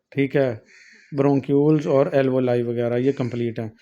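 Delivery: normal talking voice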